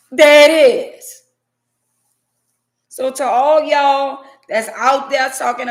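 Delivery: loud, slow, drawn-out gotcha; triumphant. Real, not acted. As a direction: crow it out like you caught them. Triumphant reveal again.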